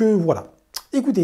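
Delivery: ordinary delivery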